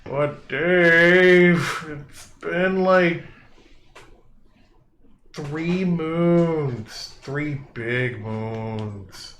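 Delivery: loud, high pleading giant; first giant complaining to Dave about hunger, loud and whiny. Acted. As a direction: whine like a hungry oaf, slowing to the end. First giant whining again.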